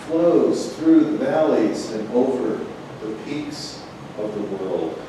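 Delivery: measured, even poetry-reading voice; steady recital cadence, lines weighted evenly; poem reading. Acted. Reads her poem Linguistics.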